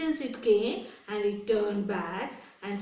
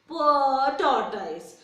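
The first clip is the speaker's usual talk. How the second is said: mock pity; 2 semitones higher, 5 dB louder, slower than usual. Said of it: "I pity you".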